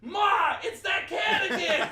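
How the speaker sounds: very high, slow, drawn-out holler; imitated excited shout. Man in the bushes calling his mom about the cat, shouting. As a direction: yell for your mother in mock alarm.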